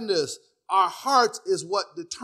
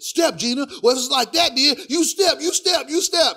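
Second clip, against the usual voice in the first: high-pitched, proud quarrelling husband; Martin; 7 semitones higher than usual. Retold or imitated. Husband daring his wife to leave, high mock bravado. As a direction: imitate a sitcom husband telling her off. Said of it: "that you seen Martin with it".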